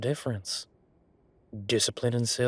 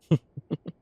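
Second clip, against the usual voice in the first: laugh; 6 semitones higher, 5 dB louder than usual. Acted. Laughs asking how it happened.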